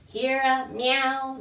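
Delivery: normal talking voice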